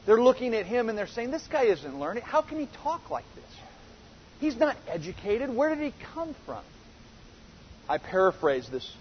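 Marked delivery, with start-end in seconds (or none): 1.3-6.6 contemptuous. Retold or imitated. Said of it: "it's a contemptuous phrase".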